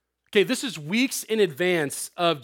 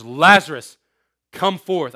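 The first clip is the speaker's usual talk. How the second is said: booming call, much louder; loud cry; 10 dB louder, slower than usual. Acted. Jesus calling Lazarus out of the tomb, loudly. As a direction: call the dead man out of the tomb. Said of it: "he cried with a loud voice".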